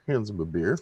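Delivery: usual speaking voice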